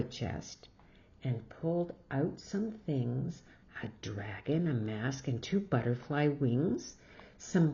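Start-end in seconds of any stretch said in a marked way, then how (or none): none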